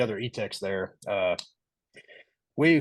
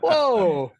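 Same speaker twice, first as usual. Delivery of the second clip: startled whoa; 5 semitones higher, 6 dB louder than usual. Retold or imitated. Defensive reaction on phone.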